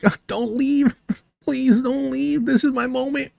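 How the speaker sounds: hammed-up fake whimper mocking a rival streamer; mock crying. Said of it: "Look, he's gonna cry".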